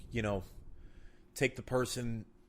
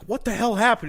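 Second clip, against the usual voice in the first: high, loud what the hell; alarmed demand; 13 semitones higher, 10 dB louder than usual. Acted. FBI examiner shouting at the candidate who just came out. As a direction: burst out shocked at the candidate.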